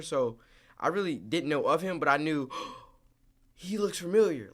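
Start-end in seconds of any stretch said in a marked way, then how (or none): 3.5-4.5 gasp, then high surprised realization; higher, lightly startled; acted recognition. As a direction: relive spotting a known face. Acts out recognizing an actor.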